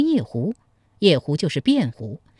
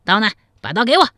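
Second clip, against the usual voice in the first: raised voice demanding from a servant; called-out order; 4 semitones higher, 4 dB louder, much faster than usual. A narrator's reading. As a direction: call out impatiently, rising louder at the end. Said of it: "对另外一个小厮喊道".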